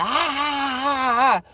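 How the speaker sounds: high-pitched exaggerated fake ha-ha; put-on cackling laughter; mock laughing. Shown as a demonstration. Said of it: "I wasn't laughing like".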